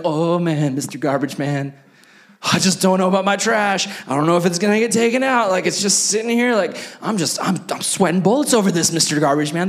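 hammed-up worrywart wringing his hands; mock anxious fretting. Worried customer pleading with garbage man.

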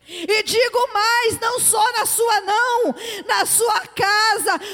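shouted, high, drawn-out proclamation; full-force preaching. Real, not acted. Preacher declaring a miracle to the congregation, impassioned shouting. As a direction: proclaim it at the top of your voice, stretching each phrase.

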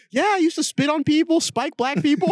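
high and bright mocking voice; very high sing-song mocking narrator; mock cheery documentary quote. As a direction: chirp the ugly fact as if charming.

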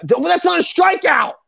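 sudden high-pitched yell; outraged shout. Real, not acted. Fan yelling at a bad strikeout call mid-conversation, outraged shout. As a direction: shout your protest at the umpire.

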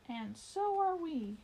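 high, loud, slow cheerful voices; chorus of friends. A narrator's reading. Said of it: "Mooseberry heard several voices say".

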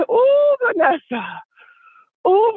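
crying out with joy, slow; excited. Real, not acted. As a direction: ooh stretched out in delight. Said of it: "but it's early in the morning".

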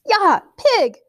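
high, loud yell; boy yelling at the pig to provoke it. A narrator's reading. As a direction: yell to make it charge. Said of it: "Nut yelled".

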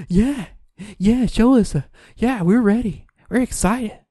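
high, eager mock crowd voices; playing the audience all pumped up. Mocking the commercial's audience, high excited voices. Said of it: "And the people are like".

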